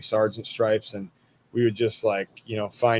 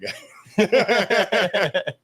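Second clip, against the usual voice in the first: slow, higher words through a real laugh; 10 semitones higher than usual. Real, not acted. Veteran remembering the soldier he smoked, laughing slowly. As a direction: laugh fondly while saying it.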